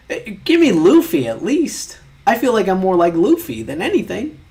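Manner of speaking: loud, high complaining plea; whiny protest. Real, not acted. Demanding a different anime character, loud whiny protest. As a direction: whine it loudly, stretching the words. Bargaining over quiz result.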